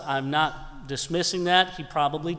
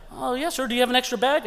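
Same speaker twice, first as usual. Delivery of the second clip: higher, lightly voiced polite attendant; mock flight attendant; 9 semitones higher, faster than usual. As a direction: chirp it like a cheerful attendant. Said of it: "She's like".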